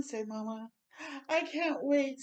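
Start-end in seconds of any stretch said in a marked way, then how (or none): 1.3-2.2 higher, bubbly excitement; eager can't wait. Acted. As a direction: burst with impatience. Dasher eager for Christmas.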